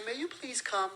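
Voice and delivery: sweetly pleading, lightly hammed-up; overly polite request. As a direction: play a daintily courteous mother asking nicely. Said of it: "She doesn't say".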